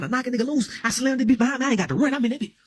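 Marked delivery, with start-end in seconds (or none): none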